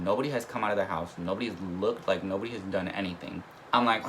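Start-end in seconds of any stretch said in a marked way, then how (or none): none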